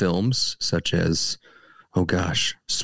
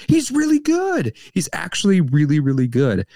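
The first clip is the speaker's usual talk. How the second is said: excited; 7 semitones higher, 3 dB louder than usual. Retold or imitated. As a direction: gush with sudden delight. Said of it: "I was like".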